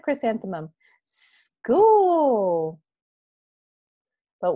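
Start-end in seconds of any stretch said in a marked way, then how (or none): 1.0-2.8 higher, louder eager exclaim; girl thrilled about her first school day; excited. Acted. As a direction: say it like the best thing ever.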